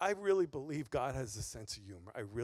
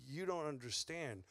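tender rebuke; 5 semitones lower, 7 dB quieter than usual. Retold or imitated. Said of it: "Jesus ... said".

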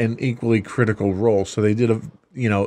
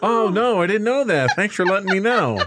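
high, sarcastic mock surprise; 10 semitones higher than usual. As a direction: fake amazement, dripping sarcasm. Mock thanks for obvious fact.

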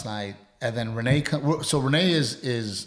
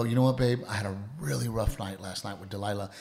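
softer, lower model of a kinder opening; gentle confiding; 5 semitones lower, 5 dB quieter than usual. Shown as a demonstration. Husband showing wife how to open the morning, gently confiding. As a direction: confide it mildly to a partner.

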